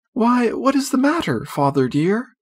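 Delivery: sweet, anxious inquiry; concerned mother. A narrator's reading. Mother asks her flustered husband what is wrong, gently worried.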